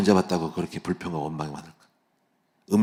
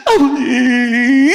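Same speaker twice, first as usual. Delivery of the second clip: drawn-out, much higher and louder wailing call; wailing cry; 10 dB louder, much slower than usual. Retold or imitated. Pastor imitates his wife crying in the basement, long high wail. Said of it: "어떻게 우냐면요".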